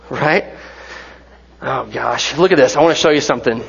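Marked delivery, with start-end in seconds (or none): none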